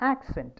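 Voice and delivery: "Accent" as a noun, weight on AC; careful isolated "Accent", first syllable prominent; stress on first syllable. Shown as a demonstration. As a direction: hit AC in "Accent" and drop the second syllable. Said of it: "ആദ്യത്തെ".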